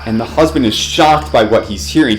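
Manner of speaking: ordinary delivery, nothing marked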